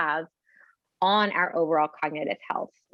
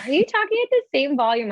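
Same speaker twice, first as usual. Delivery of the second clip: high-pitched mock-surprised question; imitating surprised patient; 9 semitones higher, 3 dB louder, faster than usual. Patient questions audiologist's volume.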